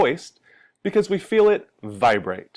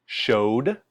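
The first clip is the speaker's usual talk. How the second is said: ordinary delivery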